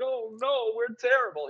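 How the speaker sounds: high-pitched hammed-up dismay; mock despair. Composer reacting to a critic, mock high-pitched despair. Acting out own automatic despair.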